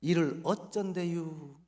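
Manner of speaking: slow, drawn-out Chungcheong dialect fretting; Chungcheong dialect dismay. Retold or imitated. Barber realizing he shaved the foreigner's head, in slow Chungcheong dialect. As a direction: drawl it like a sheepish country barber. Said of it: "사장님이 뭐라 그랬을까요".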